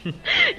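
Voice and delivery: sudden high giggle; high burst of laughter. Acted. Ellen laughing at her friend's teasing, high giggle.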